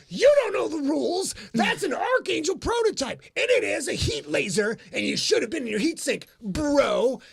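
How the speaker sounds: loud, high mock gamer rant; yelling gamer. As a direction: bellow it like an angry tabletop nerd. Gamers yelling about rules.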